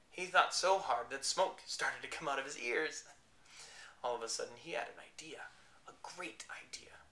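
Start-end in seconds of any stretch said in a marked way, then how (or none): 4.6-7.0 hushed excited whisper. A narrator's reading. Owl's great idea, whispered.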